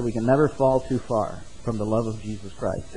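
normal talking voice

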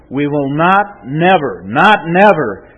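"not" and "never" each hit hard, set apart by pauses; emphatic double negative. Shown as a demonstration. Preacher driving the promise home to the congregation, emphatic. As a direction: drive home every "not" and "never", slowly. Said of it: "the Greek is very emphatic".

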